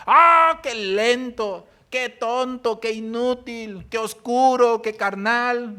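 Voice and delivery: loud, high, slow scornful jeers; mock jeering at the lazy and the dim; mocking name-calling. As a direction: spit out the insults with contempt. Preacher mocks labels thrown at people.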